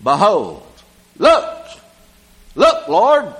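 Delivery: a convert eager to be heard; eager Zacchaeus. Acted. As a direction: Zacchaeus calling out to the Lord. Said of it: "Zacchaeus said unto the Lord".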